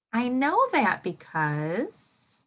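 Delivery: slow, drawn-out sentence starter with a lift; slow modelled frame. Shown as a demonstration. Teacher modelling the answer frame, slow and drawn out. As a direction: stretch it out so the kids can follow. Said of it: "This is how it will sound".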